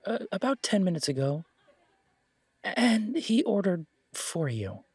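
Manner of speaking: high, flustered voice, half laughing; jittery barkeep dreading the news; nervous barkeep. Acted. [name] nervously breaks news.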